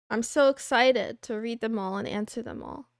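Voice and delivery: ordinary talk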